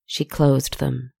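ordinary delivery, nothing marked

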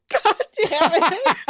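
cracking up mid-curse; host breaking into laughter at the fumble; laughing. Real, not acted.

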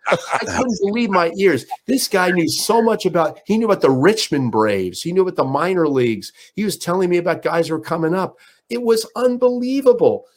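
rising to a high, amazed peak. Real, not acted. Host telling the audience about [name]'s baseball knowledge, rising amazement.

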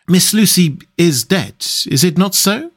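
with an effort. A narrator's reading. Doctor asking about Miss Lucy's death, with an effort. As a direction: make yourself say it, carefully. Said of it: "evidently with an effort".

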